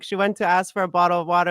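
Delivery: usual speaking voice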